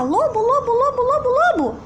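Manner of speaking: high repeated calls of lobo; calling lobo. Acted. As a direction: call out lobo again and again, high. Shouting the wolf's name again.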